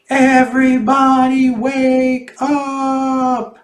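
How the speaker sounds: high, very slow stretched shriek. Acted. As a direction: shriek it long through a megaphone. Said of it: "and shrieked".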